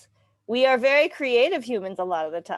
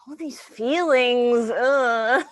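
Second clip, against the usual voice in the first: drawn-out, louder, higher groan of overwhelm; exasperated overwhelm; 4 semitones higher, 5 dB louder, much slower than usual. Acted. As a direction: groan it out, swamped by feelings. Overwhelmed by constant emotions.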